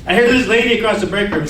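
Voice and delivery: ordinary talk, nothing marked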